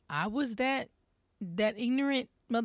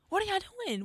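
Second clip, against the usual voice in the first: much higher, incredulous repeat of the question; high disbelief; 11 semitones higher, much faster than usual. Real, not acted. Disbelief at the crowd.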